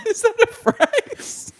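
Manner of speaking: high giggly question; laughing. Real, not acted. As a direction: ask it through laughter.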